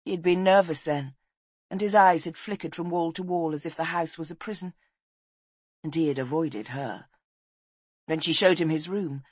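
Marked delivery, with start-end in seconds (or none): none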